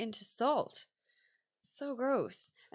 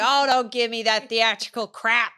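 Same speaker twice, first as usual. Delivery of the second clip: loud irritated tourist; 10 dB louder than usual. Retold or imitated. Tourist ranting.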